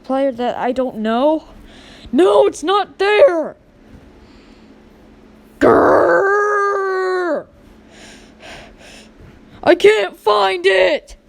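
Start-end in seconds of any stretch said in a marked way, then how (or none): 2.1-11.2 slow, loud shouts with a growl, higher pitch; a kid's tantrum, growling and shouting; frustrated shout. Acted. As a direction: stomp and yell at the missing toy. Kid can't find his toy.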